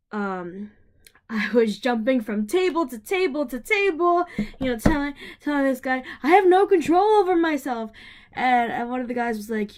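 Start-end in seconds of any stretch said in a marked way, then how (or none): 6.2-7.9 loud, high retold cry; manic outburst. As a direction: blurt it out loudly, pitch jumping up. Retells own manic outburst.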